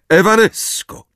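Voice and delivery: sharp loud incantation; commanding spell. Acted. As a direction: fling the spell out. Snape casting a spell.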